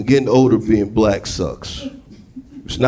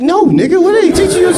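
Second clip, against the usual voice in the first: indignant; 3 dB louder than usual. Retold or imitated. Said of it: "He's like".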